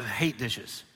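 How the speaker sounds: ordinary talk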